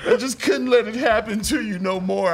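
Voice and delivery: high, teary, hammed-up sister apology; sobbing apology. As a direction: wail the sorry through laughter. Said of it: "And Asia's".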